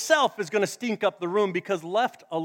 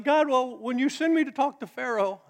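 a touch higher, mildly nervous Moses; high-pitched Moses; 7 semitones higher, 3 dB louder than usual. Retold or imitated. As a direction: lightly play a nervous Moses. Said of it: "Moses had a high-pitched voice like that".

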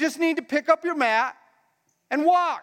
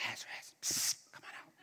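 hushed psst and whisper; secretive whisper; 17 dB quieter than usual. Acted. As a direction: whisper it so nobody else hears. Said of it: "He could have been like".

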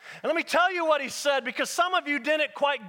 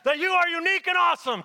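raised, higher preacher's proclamation; loud preacher voice; 6 semitones higher, 4 dB louder, faster than usual. Shown as a demonstration. Preacher telling people to remind their neighbor, loud preacher voice. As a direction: punch it out like a preacher. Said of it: "with your nice, loud preacher voice".

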